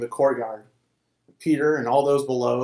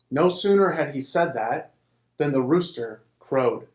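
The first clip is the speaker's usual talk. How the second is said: ordinary delivery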